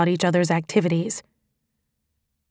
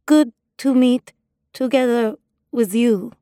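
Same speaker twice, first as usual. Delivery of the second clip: put-on Russian accent; 4 semitones higher than usual. Disguised woman greeting the strip club owner, in a Russian accent. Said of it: "I hated using improper English".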